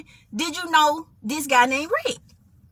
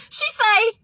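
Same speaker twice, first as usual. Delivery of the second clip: ordinary talk, nothing marked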